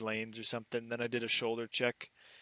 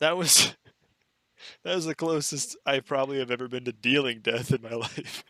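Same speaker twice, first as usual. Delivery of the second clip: laughing; 8 dB louder than usual. Real, not acted. Laughing admission of near miss.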